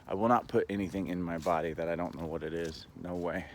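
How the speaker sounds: ordinary talk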